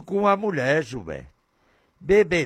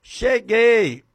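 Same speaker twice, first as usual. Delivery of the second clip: higher, sweet and drawn out; loving husband greeting; 8 semitones higher, 6 dB louder, slower than usual. Acted. Husband arriving home, sweetly greeting his wife. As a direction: coo it like a happy husband home. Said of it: "Aquela alegria de chegar em casa".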